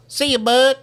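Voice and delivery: put-on high, loud toddler with a country twang; two-year-old country accent, loudly piped up; thick country accent. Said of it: "my two-year-old with the thickest country accent goes".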